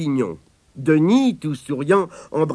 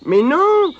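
indignant denial; 13 semitones higher, 6 dB louder than usual. Acted. Abigail denies, higher voice.

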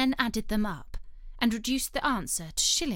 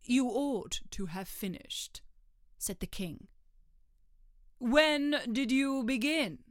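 king voice; 3 semitones higher than usual. Acted. King questions Hatter.

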